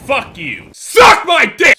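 slow, high, very loud insult; furious shout. Real, not acted. Gamer cursing the winning opponent, furious shout. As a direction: shout each word at the opponent.

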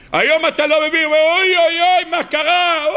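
high, loud mock lament of oy oy; mock wailing. Preacher imitating a man crying over his loss, mock wailing. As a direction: wail it like someone who doesn't understand.

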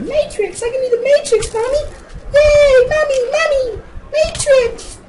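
put-on very high, loud squealing child; excited little-kid voice. Kid showing Mommy a Matrix trick, in shrieky high voice. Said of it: "And here I'm saying".

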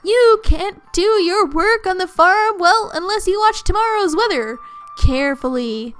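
animated cartoon presenter; cheery put-on host reading; weather-program sprite. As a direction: address viewers as a little TV sprite.

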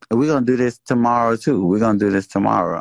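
loud, hammering each word; fired-up announcement. Real, not acted. Announcing a callout post, fired up.

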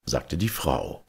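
plain reading voice

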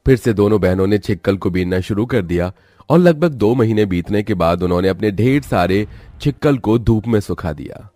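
ordinary delivery

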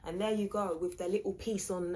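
ordinary talk

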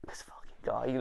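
whispered aside in disbelief; very soft, voiceless grumble; muttered exasperation. Retold or imitated. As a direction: curse him under your breath. Retold exasperated private thought.

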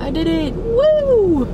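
high, loud victory whoop; excited cheer. Real, not acted. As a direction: cheer it out, thrilled. Triumph after managing a task.

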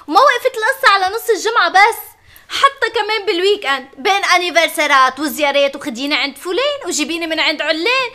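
ordinary delivery

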